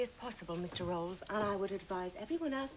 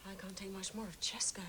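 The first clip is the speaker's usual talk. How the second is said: hushed, unvoiced aside; whispered aside; 4 semitones lower, 8 dB quieter than usual. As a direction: whisper it under your breath. Whispered aside twisting the line.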